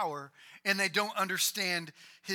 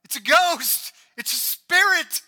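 loud, high panicked cry; terrified cry; 7 semitones higher, 11 dB louder than usual. Retold or imitated. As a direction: shout it like you've seen a ghost. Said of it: "they cried out".

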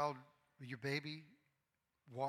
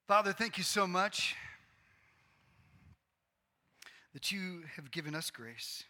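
earnest prayer, opening loud then settling; pastoral prayer; 5 dB louder than usual. Acted. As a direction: pray over the congregation, fervent then gentle. Pastor leads closing prayer.

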